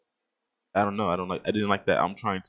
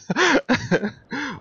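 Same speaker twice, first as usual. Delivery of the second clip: loud, high helpless laugh; high burst of laughter; 7 dB louder than usual. Real, not acted. Gamer laughing at his own freak-out, loud high laugh.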